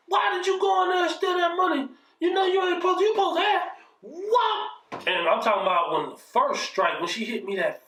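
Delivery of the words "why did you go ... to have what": mother yelling. Retold or imitated. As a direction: tear into him over the stolen money. Mother yelling while beating him.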